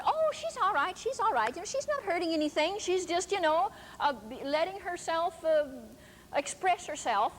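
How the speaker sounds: high light dismissive voice, brushing it off; man's easygoing reply. Retold or imitated. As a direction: wave it away lightly, pitched high. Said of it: "And he said".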